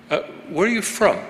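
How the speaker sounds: a hint of a polite questioner; curious foreigner asking. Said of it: "people would say".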